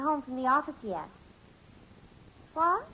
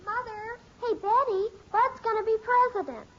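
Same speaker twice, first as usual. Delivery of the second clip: calling out; 7 semitones higher, 4 dB louder than usual. Acted. Sister calls out the news.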